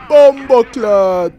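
slow drawn Jamaican curse; Jamaican accent. Pretend.

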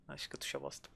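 low whispered grumble. Real, not acted. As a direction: whisper it under your breath. Muttering at controls.

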